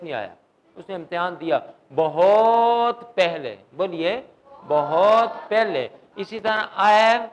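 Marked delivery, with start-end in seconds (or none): 1.9-3.6 "بہت" stretched long and loud; drawn-out emphasis. Shown as a demonstration. Teacher stressing remote past to students, "بہت" drawn out. 4.7-5.9 long, slow "بہت", then "پہلے"; drawn-out emphasis. Shown as a demonstration. Teacher leading students in repetition, "بہت" drawn out. Repeats for class to echo.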